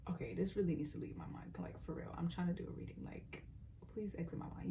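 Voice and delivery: hushed private aside; whisper. Real, not acted. Reader muttering to herself mid-reading, whispered aside. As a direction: whisper it under your breath to yourself. Aside while trying to read cards.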